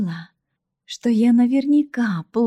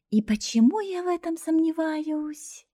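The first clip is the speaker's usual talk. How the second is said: copied grown-up phrase; 9 semitones higher than usual. Acted. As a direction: parrot an adult's sceptical line. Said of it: "Явно скопировав сказанную кем-то фразу". (протяжно, передразнивая взрослого)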